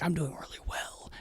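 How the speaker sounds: whispered inner voice. Retold or imitated. Comic voicing his own self-talk, in a whisper. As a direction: whisper it to yourself.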